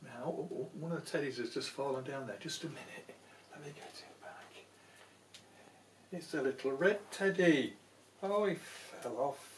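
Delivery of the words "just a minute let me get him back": quick hushed aside; whispered aside. Real, not acted. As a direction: mutter it under your breath. Aside while fixing a teddy.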